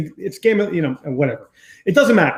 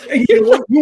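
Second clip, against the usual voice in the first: rally the listeners with a sudden high burst; excited high shout; 8 semitones higher, 6 dB louder, faster than usual. Real, not acted. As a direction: voice jumps high and loud, fired up. Builds to rallying cry.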